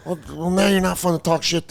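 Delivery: mock deflated heckler. Imitating deflated Philly fans.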